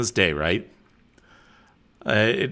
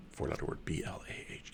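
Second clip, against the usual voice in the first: whispered aside; 9 semitones lower, 21 dB quieter than usual. Real, not acted. Spelling out blah under breath.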